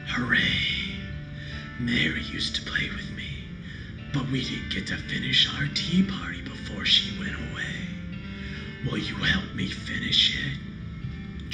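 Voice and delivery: creepy whispered doll voice; eerie breathless doll whisper; talking doll. Acted. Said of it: "its mouth begins to move".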